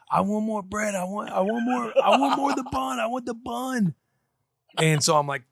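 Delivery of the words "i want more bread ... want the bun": put-on high, laughing whiny little girl; whiny toddler voice. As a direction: beg like a fussy toddler. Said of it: "she's like".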